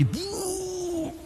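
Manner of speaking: high drawn-out "buuuh" noise; mock blast sound. Preacher imitating the doomsday crash, high soft whoosh.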